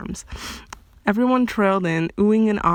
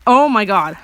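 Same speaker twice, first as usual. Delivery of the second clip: exasperated amusement; 7 dB louder than usual. Real, not acted. Reader reacts to a flub.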